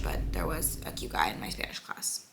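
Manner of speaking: secret whisper. Real, not acted. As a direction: confide it under your breath. Confiding about a crush.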